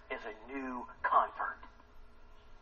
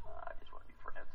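breathy whisper, half mocking; whispered brush-off; 7 dB quieter than usual. Peter whispering his excuse to Jesus. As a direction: mutter it under your breath.

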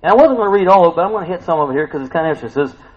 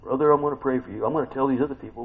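lightly voiced hushed, quick confiding; a hint of a gossip, softer and fast; gossiping churchgoer; 6 semitones lower, 8 dB quieter, faster than usual. Preacher playing a churchgoer passing on prayer needs, hushed mock confiding. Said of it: "it's almost like".

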